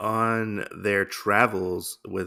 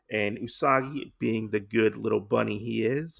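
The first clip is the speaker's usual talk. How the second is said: ordinary delivery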